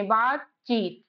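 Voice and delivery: बातचीत said slowly, बात and चीत pulled apart; slow spelling-out. Shown as a demonstration. Teacher slowing बातचीत down so students hear its parts. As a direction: sound out बातचीत slowly, one part at a time. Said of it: "आ नहीं रुकते".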